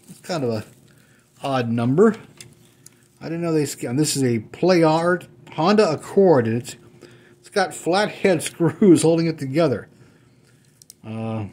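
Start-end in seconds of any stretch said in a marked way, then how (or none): none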